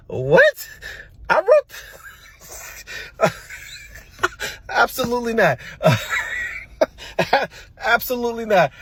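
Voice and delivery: high-pitched, breaking up with laughter; laughing disbelief. Real, not acted. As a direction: crack up at your own words. Laughs at own childhood report.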